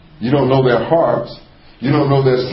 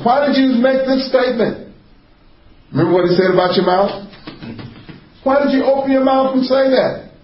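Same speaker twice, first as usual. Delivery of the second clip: much higher, piercing questioning voice; stern apostle; 13 semitones higher than usual. Acted. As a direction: demand it like an apostle exposing a lie. Voicing Peter's stern question.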